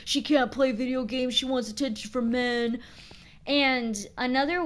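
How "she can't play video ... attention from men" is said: a sneering online troll, lightly voiced; a hint of a spiteful troll; mocking Reddit troll. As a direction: sneer it like a nasty forum poster. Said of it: "and they're like".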